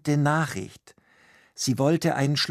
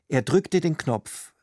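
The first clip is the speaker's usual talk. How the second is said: ordinary delivery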